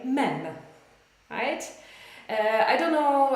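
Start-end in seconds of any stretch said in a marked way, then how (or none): 0.0-0.4 "mem" with a short open e; Polish pronunciation. Shown as a demonstration. English teacher showing learners the Polish word, Polish pronunciation of "mem". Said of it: "In Polish, we say".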